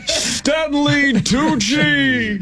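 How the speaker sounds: very high, very slow announcer cry. Acted. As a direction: yell the name like a game-show host. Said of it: "Bane doing Don Pardo is what that was".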